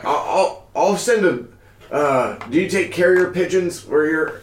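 friendly farmer asking about pigeons. Acted. GM voices farmer Fergie.

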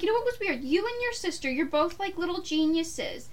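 high, bright retold mom. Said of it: "She's like".

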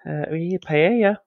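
high, put-on Spanish accent; lightly hammed Spanish accent voice; mock Spanish accent. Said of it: "if I was to do".

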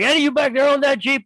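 imitated biker challenge, pitched high; demanding biker. Biker questions the workers behind the counter, imitated demanding call. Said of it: "he's like".

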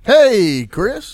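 loud, high-pitched hello; hearty greeting. Pretend. Host greets co-host to open the show, hearty. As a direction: call out a big, hearty hello.

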